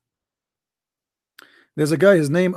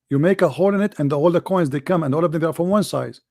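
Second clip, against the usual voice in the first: usual speaking voice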